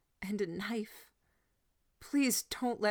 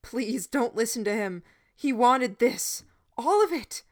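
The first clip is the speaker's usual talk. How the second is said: loud pleading; 5 semitones higher, 7 dB louder than usual. A narrator's reading. Boy pleading loudly with crowd.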